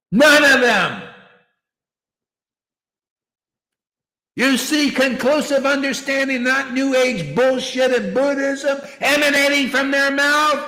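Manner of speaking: loud, high, hammering challenge; emphatic. Real, not acted. Preacher challenges followers about their gurus, emphatic high shouting. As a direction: shout each demand and drive the answer home. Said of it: "they wonder why I get so emphatic".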